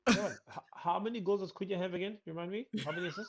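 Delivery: loud, high mocking questions; sarcastic teasing. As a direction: needle him with fake innocence. Mocking teasing about Cunha.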